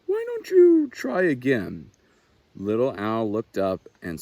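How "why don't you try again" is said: put-on high, loud encouraging young fawn; friendly fawn. A narrator's reading. The fawn urging Little Owl to try again, high and bright. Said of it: "said a voice nearby".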